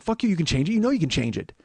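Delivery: acted-out irate demand; retold angry insistence. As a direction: argue it heatedly. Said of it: "I'm kinda yelling".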